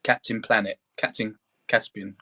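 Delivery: ordinary talk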